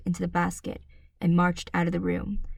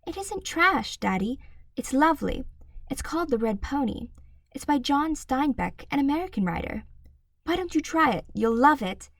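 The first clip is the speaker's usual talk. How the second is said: put-on high young girl, eager; a bright girl coaxing her dad; high, earnest child's voice; little girl voice; 7 semitones higher than usual. A narrator's reading. Matilda voiced by narrator.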